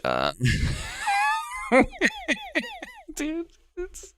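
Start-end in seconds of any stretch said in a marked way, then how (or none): 0.4-2.8 high wheezing laugh. Real, not acted. Host cracking up at the mayor's clip, high laughter. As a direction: crack up, pitch shooting way up.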